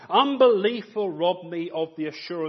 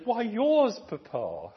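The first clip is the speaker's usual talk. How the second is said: put-on abashed child; 8 semitones higher, slower than usual. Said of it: "And the child abashed said".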